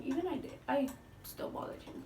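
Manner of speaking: breathy whisper aside to the camera. Real, not acted. Vlogger admitting to viewers she kept bothering him, whispered. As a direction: confide it under your breath.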